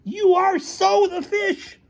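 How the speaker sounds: very high-pitched insistence; high, emphatic quarrel voice; outraged accusation. Acted. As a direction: squeal it like an incensed accuser. Shouting match continues with accusation.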